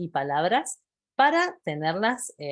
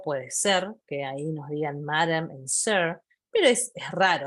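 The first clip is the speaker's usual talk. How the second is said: usual speaking voice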